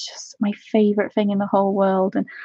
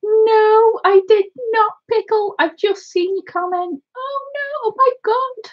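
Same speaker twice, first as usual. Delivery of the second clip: high, excited outburst; thrilled surprise; 12 semitones higher than usual. Real, not acted. Streamer just seeing a viewer's comment, thrilled surprise. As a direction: squeal it out in disbelief.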